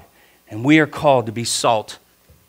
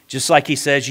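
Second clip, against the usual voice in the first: normal talking voice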